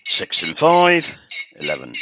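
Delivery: slow, high drawn-out call; bingo caller. Pretend. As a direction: stretch the numbers like a caller. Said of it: "How would you read the bingo numbers".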